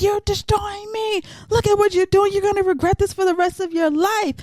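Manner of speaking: high, fast desperate plea; mock heartbroken man. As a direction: plead like a wrecked lover. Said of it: "he's like".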